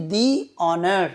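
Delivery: "দি" with a long ee before "অনার"; THE as "dee". Shown as a demonstration. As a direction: say "দি", pause, then "অনার" with silent h. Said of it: "সেখানে দি উচ্চারণ করা হচ্ছে". ("the"-কে "দি" উচ্চারণ)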